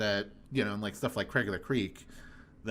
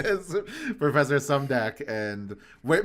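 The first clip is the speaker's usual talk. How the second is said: talking through real laughter; laughing; 4 semitones higher, 3 dB louder than usual. Real, not acted. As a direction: get the words out while cracking up. Laughing about Tom Kenny casting.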